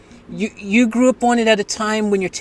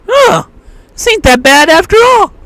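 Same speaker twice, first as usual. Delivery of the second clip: surprised acceptance; 12 semitones higher, 7 dB louder than usual. Retold or imitated. Brainwashed kid's sudden verdict.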